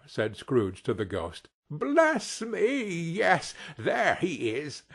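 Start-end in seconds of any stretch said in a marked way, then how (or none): 1.7-4.8 high, marvelling exclamation; amazed, pitched-up delight; delighted wonder. A narrator's reading. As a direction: exclaim fondly at seeing an old friend. Scrooge recognising his old friend.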